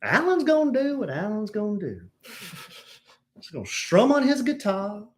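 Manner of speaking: hammed-up high voice, building to a loud finish; Carolyn Marks. Host imitating a listener commenting on Alan, hammed-up impression. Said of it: "Is that how Carolyn talks?".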